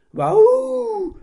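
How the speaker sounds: very high, loud drawn-out woof; the dog protesting with a long yowl; long howling bark. Acted. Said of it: "mit langem U, schrie Schnorki".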